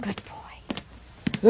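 hushed praise under the breath; whisper. Acted. Mother praises her son in a whisper. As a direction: breathe it so he barely hears. Parents hushing as child leaves.

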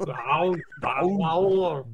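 put-on drawn-out Austrian-accented word; mock Austrian action-hero echo; Schwarzenegger. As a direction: play with the groaned Austrian vowel. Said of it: "I like the little".

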